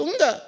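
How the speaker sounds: a sharp high no, cutting off the idea; emphatic no. Real, not acted. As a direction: say it like a firm correction. Answering his own question firmly. (tegas: "Enggak" dengan nada tinggi)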